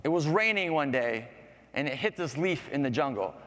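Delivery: ordinary delivery, nothing marked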